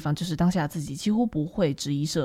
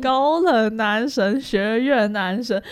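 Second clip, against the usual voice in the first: slow savoring; 3 semitones higher, slower than usual. Real, not acted. Gushing over the aloof guy.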